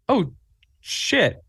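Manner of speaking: high-pitched shocked gasp of disbelief; stunned surprise. Retold or imitated. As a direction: caught totally off guard. Stunned reaction to winning Emmy.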